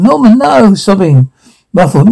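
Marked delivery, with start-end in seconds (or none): 0.0-0.7 high, wailing plea; acted distressed cry. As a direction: plead in a high voice, not shouting. Acted cry to Norman during attack.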